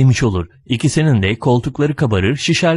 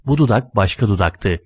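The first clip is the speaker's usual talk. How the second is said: usual speaking voice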